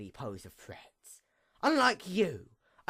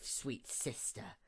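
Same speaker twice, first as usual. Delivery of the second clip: whispered taunt; 5 semitones lower, 17 dB quieter, slower than usual. Acted. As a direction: hiss sweet sister in a breathy whisper. Villain taunting her sister.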